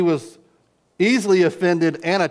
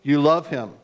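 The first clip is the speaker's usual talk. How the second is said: preacher reading scripture with fervor; reading scripture aloud; 4 semitones higher, 3 dB louder than usual. Acted. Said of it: "He says".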